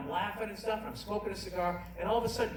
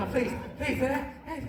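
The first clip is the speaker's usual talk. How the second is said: anxious pleading; 7 semitones higher than usual. Retold or imitated. Acted praying while tiptoeing to bedroom.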